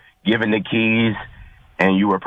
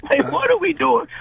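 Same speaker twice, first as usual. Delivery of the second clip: high-pitched, incredulous questioning; bewildered; 13 semitones higher than usual. Real, not acted. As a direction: ask in bewildered disbelief, pitch way up. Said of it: "I'm bewildered".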